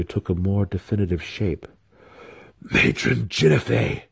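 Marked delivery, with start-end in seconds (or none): 2.6-4.0 higher, amazed exclamation; gasped. A narrator's reading. Wizard sees the completed summons, gasping in amazement. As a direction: gasp the name out in wonder. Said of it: "gasped again when the summons was complete".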